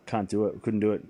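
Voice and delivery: ordinary talk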